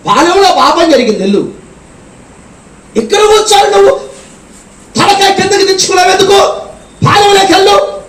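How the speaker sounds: high, stern voice of God; God ordering Joshua to get up and go; God rebuking. Acted. (యెహోషువను గద్దించే దేవుని స్వరం)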